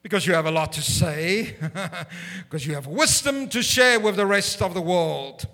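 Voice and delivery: a hearty, self-mocking boom from above; mock grand voice of God. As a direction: play God flattering the preacher, hammed up. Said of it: "This is what I thought I would hear".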